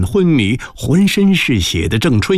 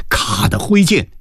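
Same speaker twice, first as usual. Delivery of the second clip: ordinary delivery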